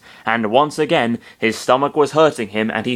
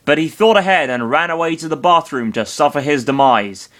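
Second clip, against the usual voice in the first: ordinary talk, nothing marked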